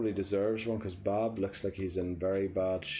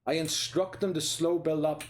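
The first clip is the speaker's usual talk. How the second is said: heated, higher frustrated rant at the player; 7 semitones higher, 3 dB louder than usual. Real, not acted. Gamer venting at his player for ignoring orders, frustrated rant. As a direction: vent at the player for kicking long. Ranting at the player's long kick.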